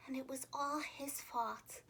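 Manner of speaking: soft sorry whisper. A narrator's reading. Narrator telling children George ruined the picnic, whispered. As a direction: breathe it out, ashamed. Sad moment, basket empty.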